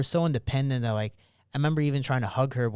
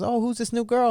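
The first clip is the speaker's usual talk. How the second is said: higher-pitched curious mom voice; imitated mother; 10 semitones higher than usual. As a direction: quote a nosy question in mom's raised pitch. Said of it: "she goes".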